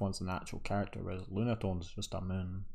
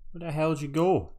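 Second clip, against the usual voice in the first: higher, louder, fast frustrated yell; exasperated shout; 7 semitones higher, 9 dB louder, faster than usual. Real, not acted. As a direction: snap at the program in annoyance. Yelling at drawing software.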